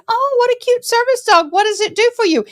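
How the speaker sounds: high syrupy gushing over the dog; mock sweet lady voice. Mocking a gushing shopper, high and sweet. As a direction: coo like a fake-nice stranger.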